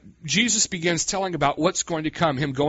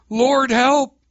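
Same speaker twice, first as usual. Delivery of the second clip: louder, firm call for help; bold plea; 10 semitones higher, 7 dB louder than usual. Shown as a demonstration. Preacher demonstrating a bold short prayer, louder. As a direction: say it boldly. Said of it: "You can go bold".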